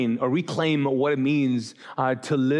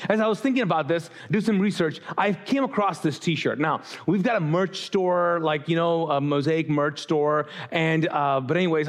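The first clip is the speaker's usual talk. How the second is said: usual speaking voice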